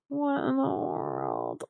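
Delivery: slow, low, soft creaky groan of disbelief; mock disbelief. King's men reacting to the fools, mock disbelief. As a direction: drag it out in hammed-up dismay.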